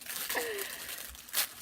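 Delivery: breathy giggle of delight; a quick excited laugh. Real, not acted. Thrilled laugh while unboxing a new palette.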